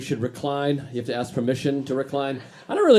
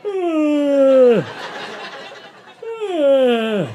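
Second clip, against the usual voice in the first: a big groaning yawn, pitched up and pushed out loud; mock loud yawn; 11 semitones higher, 4 dB louder than usual. Imitating the passenger behind him yawning out loud all flight. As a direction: ham up a yawn that the whole plane can hear. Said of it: "The whole flight's like".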